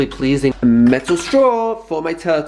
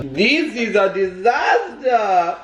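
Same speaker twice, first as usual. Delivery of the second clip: mock dramatic; 5 semitones higher, slower than usual. Mock drama over water.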